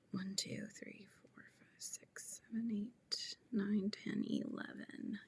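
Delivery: hushed, barely audible count; murmured stitch count; counting under the breath. Real, not acted. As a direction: count softly to yourself, mostly whispered. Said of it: "let me just count".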